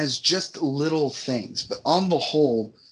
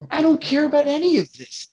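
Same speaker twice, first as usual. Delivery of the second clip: high exasperated "I don't care about any of this", stress on "don't"; exasperated outburst; 10 semitones higher, 4 dB louder than usual. Real, not acted. Fed up with the film, high exasperated rant. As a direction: let "don't" peak and fall away.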